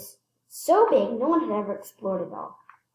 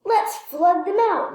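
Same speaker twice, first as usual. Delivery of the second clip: high, louder and slow; eager idea; 10 semitones higher, 5 dB louder than usual. Acted. Lewis tells the men his plan, eager and drawn out. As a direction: announce the plan with relish. Lewis proposes flooding the holes.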